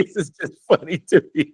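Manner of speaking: talking through helpless giggles; laughing. Real, not acted. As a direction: apologise while cracking up. Listener cracks up at story.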